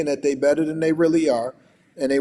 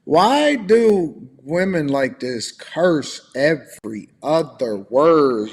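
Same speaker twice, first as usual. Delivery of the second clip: slow disbelief; 3 dB louder, much slower than usual. Real, not acted. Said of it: "mocking disbelief".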